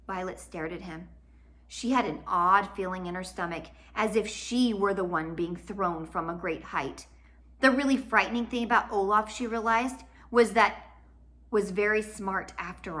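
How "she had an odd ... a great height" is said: plain reading voice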